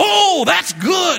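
high, slow, shouted mock delight; delighted customer. Preacher plays the customer, shouting high delight. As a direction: whoop it like an excited customer. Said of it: "The guy in the chair would go".

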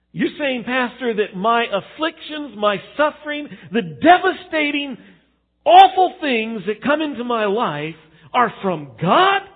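high, loud, disbelieving protest; hammed-up indignant question; incredulous objection. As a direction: challenge the pastor in mock outrage, pitch climbing. Acts out listener challenging pastor.

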